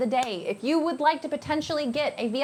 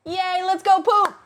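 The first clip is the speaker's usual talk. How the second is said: loud, high, cheering yell; excited cheer; 8 semitones higher, 7 dB louder than usual. Real, not acted. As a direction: whoop it up for the newcomer. Cheering new member.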